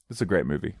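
ordinary delivery

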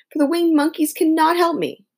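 put-on a girl about to cry; near tears. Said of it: "ready to cry with disappointment".

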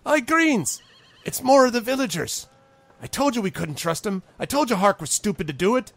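high, loud goblin griping; high-pitched goblin alarm; complaining goblin. Acted. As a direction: gripe to your fellow guard in a high voice. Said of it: "the other one looks at him and says".

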